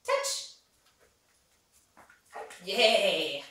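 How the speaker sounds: ordinary talk, nothing marked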